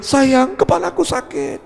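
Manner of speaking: high-pitched whine to a spouse; spouse's complaint. Acted. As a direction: play a wife moaning for sympathy. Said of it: "Datang ke istri atau suami boleh". (merengek manja ke pasangan)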